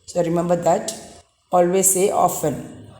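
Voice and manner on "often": OFTEN said "offen"; silent T. Shown as a demonstration. Teacher tells pupils always say often, silent T. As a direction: leave the T out of "often". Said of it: "T is silent".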